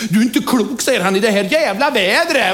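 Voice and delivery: high, loud outraged punchline; indignant groom. Acted. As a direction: scoff at her, appalled.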